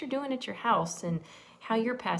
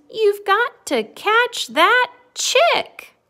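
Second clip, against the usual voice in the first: high, loud, drawn-out cry; gleeful slow acted shout; merry storybook call; 13 semitones higher, 13 dB louder, much slower than usual. A narrator's reading. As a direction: stretch it out playfully as amused friends. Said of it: "laughed his friends".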